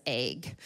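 "egg" said as "aig"; long-A egg. Retold or imitated. Mother mimics her child's word, long-A "egg". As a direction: give "egg" a long A as in vague. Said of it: "one of my child says egg".

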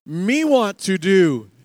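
put-on loud, slow baby-talk demand; insistent toddler. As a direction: toddler baby talk, each word pushed out. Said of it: "you were like".

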